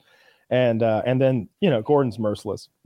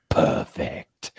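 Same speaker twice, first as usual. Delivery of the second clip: lower, drawn-out verdict; quoting Gordon; 11 semitones lower than usual. Retold or imitated. Gordon giving the verdict on the pie, lower and drawn out. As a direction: deliver it like a grave judge. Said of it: "And he's like".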